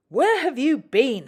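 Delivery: sharp, loud and high demand; stern. A narrator's reading. Controller scolding Rusty, stern loud demand. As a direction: scold the late engine. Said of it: "he asked sternly".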